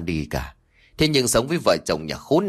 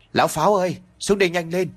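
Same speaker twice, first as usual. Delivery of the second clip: raised, higher call across the house; 7 semitones higher, faster than usual. A narrator's reading. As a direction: call him down from upstairs. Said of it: "gọi với lên trên".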